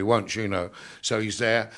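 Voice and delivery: ordinary talk, nothing marked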